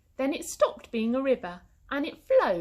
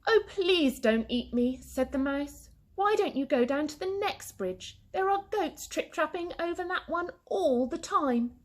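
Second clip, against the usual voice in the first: pleading mouse; 8 semitones higher than usual. A narrator's reading.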